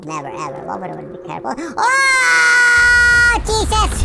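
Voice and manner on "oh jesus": long high, loud yell of alarm; startled scream. Real, not acted. Gamer yelling as a boulder comes, startled scream. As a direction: scream out as the danger hits.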